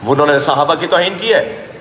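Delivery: ordinary delivery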